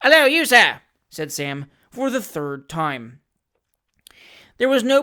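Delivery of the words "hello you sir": loud, high, fierce hail across the court; fierce shout. Acted. Sam hails the stranger up the court, fierce shout. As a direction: bark the greeting to stop a stranger. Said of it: "brought to again by another shout".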